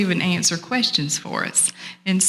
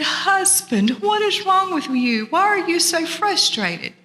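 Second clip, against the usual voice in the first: much higher-pitched wifely questioning; concerned turtle wife; 11 semitones higher than usual. Acted. Miss Turtle asks her frustrated husband what is wrong, concerned.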